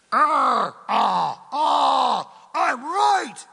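high, loud, insistent cry; stubborn shout. Acted. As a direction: holler that you're right. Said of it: "big fat airplanes looking at each other".

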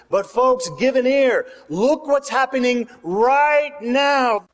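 urgent high call to the crowd, last words stretched; Peter calling out. Acted.

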